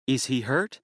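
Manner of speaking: frightened wife, voice wavering. A narrator's reading. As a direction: ask in trembling fear. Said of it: "Her voice wavered".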